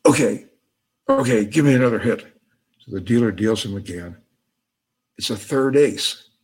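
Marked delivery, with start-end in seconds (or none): 0.1-2.3 louder, hurried giving-in; acted fed-up compliance; mock exasperated surrender. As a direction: give in fast and loudly. Joke character gives in, exasperated.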